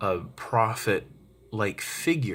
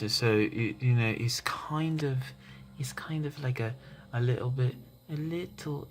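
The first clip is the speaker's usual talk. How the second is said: put-on higher, light loose English accent; loose British accent; 4 semitones higher, 3 dB quieter than usual. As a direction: mimic a mild, not very thick English accent. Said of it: "And he talk, it, it talks like this".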